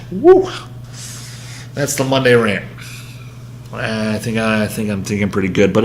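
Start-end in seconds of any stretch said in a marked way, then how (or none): 0.1-0.5 excited whoop. Real, not acted. Release shout after rant.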